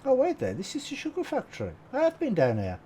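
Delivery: high-pitched, caught off guard; surprised. Real, not acted. Suddenly recognises the place.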